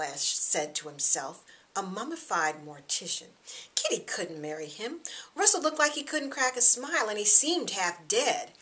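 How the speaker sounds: ordinary delivery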